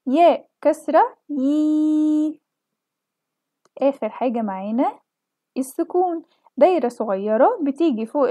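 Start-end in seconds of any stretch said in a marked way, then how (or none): 0.1-2.4 ياء كسرة يي said very slowly, يي with a thin short i; teacher sounding out يي with kasra. Shown as a demonstration. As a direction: break your voice on يي. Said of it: "بتخلينا نكسر صوتنا".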